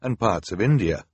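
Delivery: usual speaking voice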